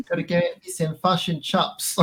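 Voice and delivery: hammed-up New Zealand accent, pitched high; Kiwi accent. Shown as a demonstration. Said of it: "We do a Kiwi one".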